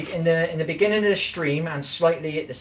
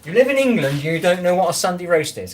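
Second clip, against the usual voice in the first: loud, higher teasing disbelief; mock disbelief; 6 semitones higher, 6 dB louder than usual. Real, not acted. Streamer ribs a viewer who lacks roast, loud mock disbelief. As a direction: pretend you're shocked at them.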